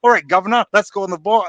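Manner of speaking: hammed-up cheery English accent. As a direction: imitate an actor slipping into an English accent. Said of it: "he starts telling his English accent".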